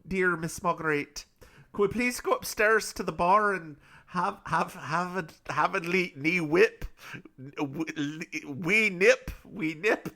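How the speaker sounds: hammed-up Scottish brogue; comic, halting Scottish lilt; Scottish accent. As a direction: stumble through a bad Irish-turned-Scottish accent. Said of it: "he's Scottish instead of Irish".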